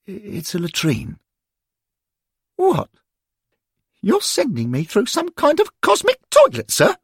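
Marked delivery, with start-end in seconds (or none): 2.6-7.0 put-on much higher, outraged boy's voice; a lad aghast at the plan; shocked schoolboy Derek. A narrator's reading. Derek told the portal is a latrine, high and aghast.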